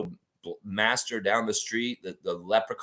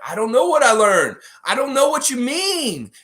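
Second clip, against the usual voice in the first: loud, high wail of frustration; hammed-up helpless yell; exasperated Raptor; 13 semitones higher, 9 dB louder than usual. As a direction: shout it high, at wits' end. Frustrated Raptor answers the guru.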